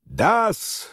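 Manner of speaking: sharp high cry, volume held back; mock thunderous cry. A narrator's reading. Drunk journalist answering the general, restrained thunder. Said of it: "закричал он громовым голосом".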